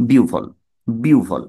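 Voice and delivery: বিউভল said as biu-vol, gliding i to u; two-part biu-vol, no h sound; correct pronunciation. Shown as a demonstration. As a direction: model বিউভল as biu-vhol, falling. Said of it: "তাহলে হয়ে গেল".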